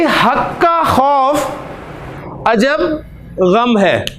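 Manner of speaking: each word drawn out slowly for writing down; slow dictation. Shown as a demonstration. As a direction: dictate it slowly so they can note it. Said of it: "जुमला है जी". (धीमे-धीमे लिखवाते हुए)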